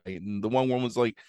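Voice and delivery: usual speaking voice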